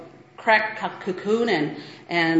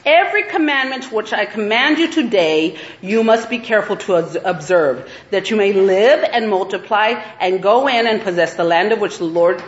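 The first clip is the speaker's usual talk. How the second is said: louder, measured scripture reading voice; 3 semitones higher, 5 dB louder than usual. Acted. Preacher reading Deuteronomy to her sisters, proclaiming. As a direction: proclaim the verses to the congregation. Reads scripture passage aloud.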